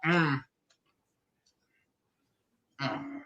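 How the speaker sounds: talking through a mouthful; muffled by food; mouth full. Real, not acted. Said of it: "I can't tell my mouthful".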